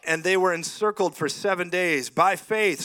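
emphatic sermon reading; preaching. Acted. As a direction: preach it to the crowd. Preaching Hebrews eleven.

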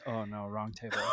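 catching himself too late; muttered realization. Retold or imitated. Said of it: "he was like".

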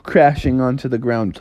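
usual speaking voice

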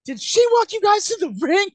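very high, squealed question; retold indignant amazement. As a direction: imitate a child's high, incredulous question.

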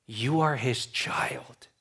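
slow, soft whisper; hushed assurance. Real, not acted. Preacher telling Christians whose child they are, hushed assurance. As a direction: drop to a slow, intimate whisper.

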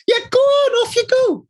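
very high mock-wife voice, shooing him along; hammed-up wife urging him on; insistent wife. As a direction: wave him off in a squeaky imitation.

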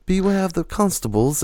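hammed-up British caution about cops; British accent. Mocking the fake Liverpool guy, in a British accent. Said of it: "He's like".

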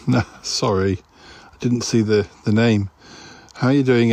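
usual speaking voice

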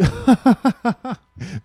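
loud high mocking cackle; big high put-on laugh; imitated laugh. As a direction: mimic someone bursting out laughing. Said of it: "her laugh".

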